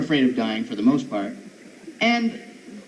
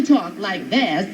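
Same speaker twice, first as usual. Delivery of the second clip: slow, exaggerated camp lilt; flaming queen voice; much slower than usual. Shown as a demonstration. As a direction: drag it out in mock flamboyance. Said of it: "Flaming queens".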